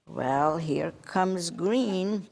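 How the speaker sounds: plain reading voice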